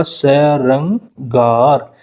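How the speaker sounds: "श्रृंगार" very slowly, syllable by syllable; correct form sounded out. Shown as a demonstration. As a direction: stretch "श्रृंगार" into three parts, sa-rang-gaar. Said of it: "शुद्ध रूप आपका".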